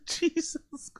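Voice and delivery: squeaked out softly through laughter; real laughing fit, very high and weak; helpless laughter. Real, not acted. Laughing at the clip's confession.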